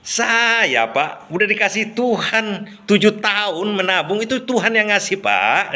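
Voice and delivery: carefree and unbothered; relaxed errand boy. Retold or imitated. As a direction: errand boy calmly answering his boss. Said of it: "Dengan santainya".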